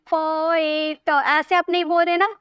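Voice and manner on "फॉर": फॉर stretched out in separate pieces; फॉर said haltingly, sound by sound; broken into sounds. Shown as a demonstration. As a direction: draw फॉर apart bit by bit. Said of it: "तोड़ तोड़ के बोल रहे".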